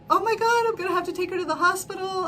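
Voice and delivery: fast panicky thought; panicked inner voice. Retold or imitated. Her inner panic about taking mom to hospital, high and rushed. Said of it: "in my mind, I'm like".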